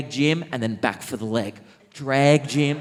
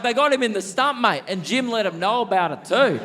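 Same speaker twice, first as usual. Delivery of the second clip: high-pitched, animated retelling; gleeful indignation; 6 semitones higher than usual. Retold or imitated. Veteran telling of mate shot in stump, gleefully indignant. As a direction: crow about it in a raised pitch.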